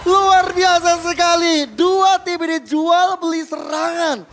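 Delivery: slow, loud drawn-out awe; amazed hype. Real, not acted. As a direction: stretch it out in big wonder. Caster amazed at fight.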